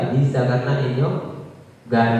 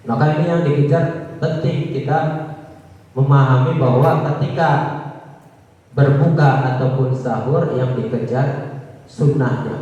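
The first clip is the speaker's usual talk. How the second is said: ordinary talk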